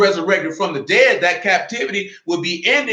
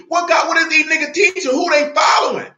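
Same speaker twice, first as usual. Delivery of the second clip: preaching shout; 8 semitones higher than usual. Real, not acted. Preacher scolding followers of men, high indignant shout. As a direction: cry out against the false teachers. Preacher rails at false teachers.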